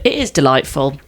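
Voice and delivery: normal talking voice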